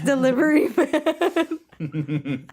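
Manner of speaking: slow, laughing dramatic title. Real, not acted. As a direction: draw it out through laughter.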